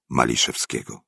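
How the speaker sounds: ordinary delivery, nothing marked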